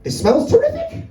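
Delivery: high, slow and delighted; cat's voice. Pretend. Cat mocking the lemon zest in the garden, high and gleeful. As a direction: sniff the air like a pleased cat. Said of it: "probably like this".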